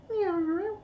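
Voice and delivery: high, soft meowing; human imitating cat meows. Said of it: "We try and go".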